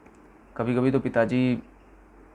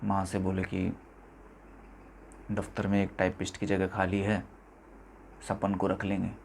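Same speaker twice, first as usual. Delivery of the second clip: subdued, resigned; 2 semitones lower, 11 dB quieter than usual. Acted. Son telling how he took his dead father's typist job, subdued. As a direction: let it sink softly into resignation.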